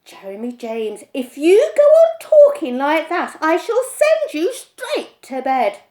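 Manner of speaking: stern scolding. A narrator's reading. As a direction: warn the boy off. Mother threatens.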